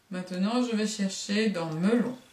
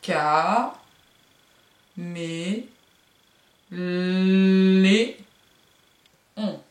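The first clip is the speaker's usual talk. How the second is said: "Ca, mé, lé, on" with "lé" held long and loud; syllables with long l; 4 dB louder, much slower than usual. Shown as a demonstration. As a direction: stress the lé with a long l. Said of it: "où se trouve le bruit de la limace".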